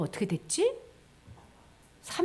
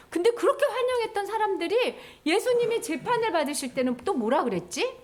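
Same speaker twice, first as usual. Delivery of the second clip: ordinary talk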